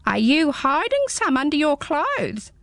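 high, small squeaky creature; a tiny curious hedgehog peering up; high little hedgehog, puzzled. Acted. Hedgehog speaking.

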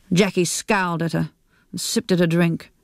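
ordinary delivery

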